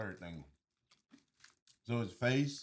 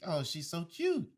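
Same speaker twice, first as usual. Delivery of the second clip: high, slow gushing coo; cooing over a baby; 10 semitones higher, slower than usual. Retold or imitated. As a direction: coo it like admiring fans. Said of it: "And everybody's like".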